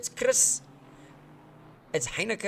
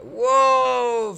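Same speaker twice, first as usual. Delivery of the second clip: sharp, loud surprised whoa; loud gasp of surprise; startled whoa; 6 semitones higher, 8 dB louder than usual. Real, not acted. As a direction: react to a sudden slide. Sudden slide in race.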